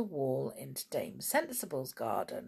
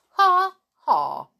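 loud, high fake "ha, ha"; taunting, spoken laugh; mocking laugh; 13 semitones higher, 11 dB louder than usual. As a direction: crow it mockingly.

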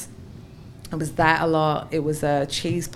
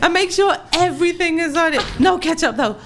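high, loud pleading; begging; 10 semitones higher, 4 dB louder than usual. Retold or imitated. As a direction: beg urgently, hammed up. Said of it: "I begged him at two".

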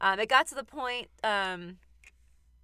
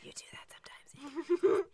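ASMR whisper; 8 semitones higher than usual. Pretend. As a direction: whisper right into the mic. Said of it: "Don't turn this into an A S M R podcast".

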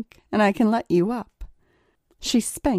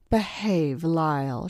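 seductive; 2 semitones lower, 4 dB quieter, slower than usual. A narrator's reading. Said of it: "she smiled seductively".

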